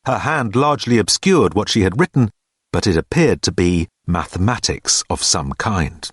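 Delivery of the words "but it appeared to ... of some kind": usual speaking voice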